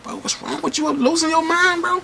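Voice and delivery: can't believe what he's seeing; shocked disbelief. Retold or imitated. Narrator scolding his friend for hitting her, shocked and high.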